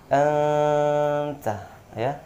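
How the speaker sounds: anta with the n held long and humming; correct nasal hold. Shown as a demonstration. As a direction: hum the n in anta for two beats. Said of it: "Jadi jelas nunnya". (dengung nun dua harokat dalam anta)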